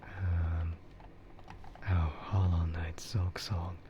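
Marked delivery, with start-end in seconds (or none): none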